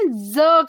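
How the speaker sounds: ज as a drawn-out buzzing z; z sound. Shown as a demonstration. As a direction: buzz ज like a z. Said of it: "एस की sound हम क्या करते हैं". (ज को घसीटकर ज़ बोलें)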